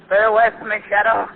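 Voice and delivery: high, loud, wild-eyed snarling threats; furious threat. Acted. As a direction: menace them like a cornered madman. Cornered killer threatens with knife.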